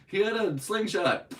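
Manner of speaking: hammed-up high, loud cop voice; mock excuse. Mocking the cop with another silly excuse, mock excuse. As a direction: cry out a ridiculous excuse, mockingly.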